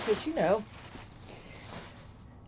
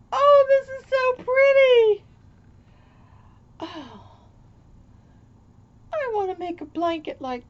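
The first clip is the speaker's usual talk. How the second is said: delighted gushing; 13 semitones higher, 5 dB louder than usual. Real, not acted. Gushing over the blanket.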